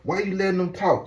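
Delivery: puzzled viewer. Retold or imitated. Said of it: "You're gonna be wondering, like".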